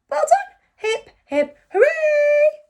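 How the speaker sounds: high, loud hooray; cheer. Real, not acted. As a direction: lead a class cheer.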